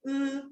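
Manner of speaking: 으 held on a level tone; vowel as 으. Shown as a demonstration. Teacher answering how it is read, a level 으. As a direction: say the written I as a plain 으. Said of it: "으라고 발음돼".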